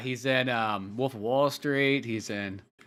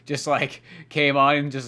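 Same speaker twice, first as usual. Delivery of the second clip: ordinary talk, nothing marked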